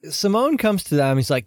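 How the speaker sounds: ordinary talk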